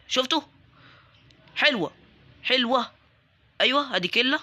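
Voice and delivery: ordinary talk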